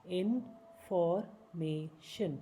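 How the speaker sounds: Information stretched slowly, each syllable apart. Shown as a demonstration. Teacher slowing information down for learners.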